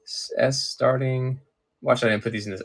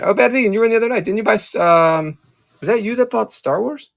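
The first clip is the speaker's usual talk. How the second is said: excited greeting; 9 semitones higher than usual. Real, not acted. Greeting a returning viewer.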